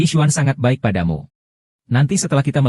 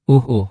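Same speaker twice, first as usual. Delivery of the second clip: labour groans; 3 semitones higher than usual. Acted. Woman in labour groaning through contractions. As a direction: push through the pain with short groans.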